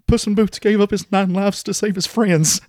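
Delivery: high, slow, whimpering mock sob; mock sniveling. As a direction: blubber it like a tearful movie fan. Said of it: "they're sniveling".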